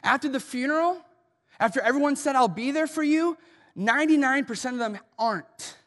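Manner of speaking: loud, driving pulpit delivery; big-hall preacher voice, pressing every line; preaching at full force. Acted. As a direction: preach it hard to the room. Preacher driving home grief points.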